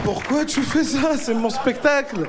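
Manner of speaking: high, drawn-out mock protest. Comedian to a spectator taking over his show, protesting. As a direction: stretch the words out in a high-pitched complaint.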